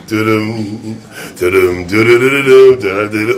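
low burbling "tulum, tululum"; climbing noises. Retold or imitated. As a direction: burble nonsense syllables for someone climbing. Hums the climbing in story.

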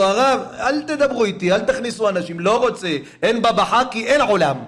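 high, loud anguished voice; grieving refusal. A narrator's reading. Baba Sali refusing visitors, weeping and loud. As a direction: push everyone away in grief. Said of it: "היה בוכה".

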